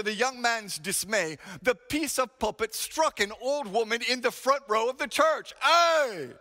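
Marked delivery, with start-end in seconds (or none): 5.6-6.3 high, loud cry of pain. Retold or imitated. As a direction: yelp as if struck. Pulpit piece strikes old woman.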